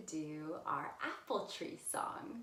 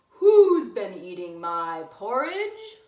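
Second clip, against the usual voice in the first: slow, much louder big bear voice; booming Papa Bear; 15 dB louder, slower than usual. Acted. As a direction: boom it out like a huge bear.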